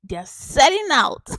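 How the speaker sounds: bursting into laughter; laughing. Real, not acted. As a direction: laugh through the words. Laughing excitedly about sales.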